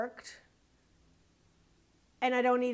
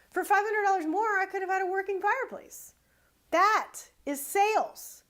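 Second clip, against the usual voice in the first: emphatic; 8 semitones higher than usual. Real, not acted. Lecturing business owners, higher, emphatic. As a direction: drive the point home with heat. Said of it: "emphatic repeat of point".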